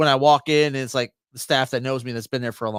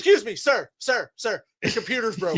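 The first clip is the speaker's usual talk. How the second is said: higher, pleading customer calling the waiter; mock whiny customer; 6 semitones higher than usual. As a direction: play a fussy patron flagging down staff. Mock customer complaining to staff.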